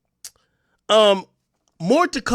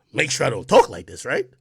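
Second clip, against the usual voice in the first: low, quiet mock voice; Flacco; 9 semitones lower, 4 dB quieter than usual. Streamer mimicking someone's way of talking, low mocking voice. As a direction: drop your voice into a soft imitation. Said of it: "Flacco voice".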